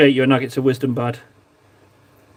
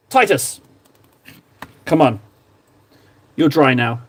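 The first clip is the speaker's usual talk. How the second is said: ordinary talk, nothing marked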